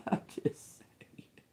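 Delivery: unvoiced whisper under the breath; whispered aside. Real, not acted. Reader to viewers after a cheeky Bible remark, whispered.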